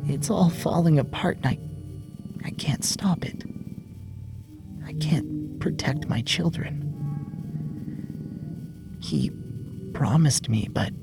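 desperate whisper. Acted. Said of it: "his mother desperate for help".

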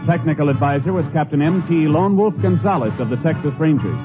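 normal talking voice

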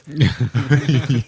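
short burst of real laughter. Real, not acted. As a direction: laugh out loud, genuinely amused. Laughing at the joke.

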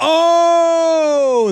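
loud, high, drawn-out "oh" cheer; excited ooh. Real, not acted. Co-host hearing the card hit thirty-five, excited shout. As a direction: whoop a long, high oh.

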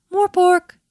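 high, loud owl call. A narrator's reading. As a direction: hoot the name out high and loud. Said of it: "Miss Ruru and Morepork called out".

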